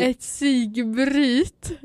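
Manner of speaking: "psykbryt" drawn out slowly with a clear y; corrected pronunciation. Shown as a demonstration. Speaker fixing own slip, "psykbryt" slow and deliberate. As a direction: stretch "psykbryt" very slowly, rising at the end.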